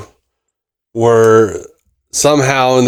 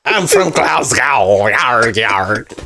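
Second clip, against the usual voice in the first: slow, giggling put-on accent; laughing mock Scottish-pirate; much slower than usual. Joke callback to his alter, laughing fake accent. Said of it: "was that supposed to be a Scottish accent".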